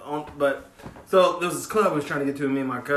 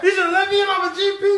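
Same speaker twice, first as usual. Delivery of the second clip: high laughing shout; 12 semitones higher than usual. Real, not acted. Comedian shouting about the bouncer, laughing. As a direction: yell it out through laughter.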